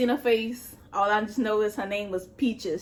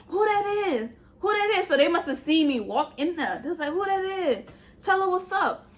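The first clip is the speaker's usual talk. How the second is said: higher voiced catcall from the cells; men calling out; 6 semitones higher than usual. Men in nearby cells asking about the new girl, mock holler. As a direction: call out across the jail block, curious and teasing.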